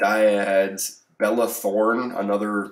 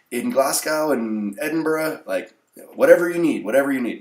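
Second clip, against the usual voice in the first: high, emphatic mock-Scottish voice; louder, hammed-up Scottish accent; terrible Scottish accent; 6 semitones higher, 4 dB louder than usual. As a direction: badly imitate an insistent Scottish seller. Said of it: "That was a terrible Scottish accent".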